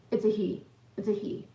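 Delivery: ordinary talk, nothing marked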